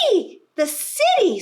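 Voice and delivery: piggy shriek; pig squeal. Acted. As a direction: squeal with excitement at the city. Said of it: "squeals the pig".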